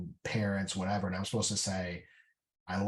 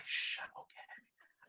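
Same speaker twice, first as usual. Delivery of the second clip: breathy unvoiced whisper to self; 11 dB quieter, slower than usual. Veteran mimicking calming himself in class, whispering. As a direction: hush it under your breath at the desk. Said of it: "like, whispering".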